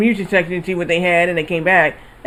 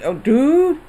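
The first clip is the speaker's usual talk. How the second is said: retold surprise; 6 semitones higher than usual. Reacts to rolling enemy.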